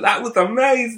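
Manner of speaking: put-on loud, high excited London accent. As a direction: shout it in thick London accent. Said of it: "in pure thick London accents".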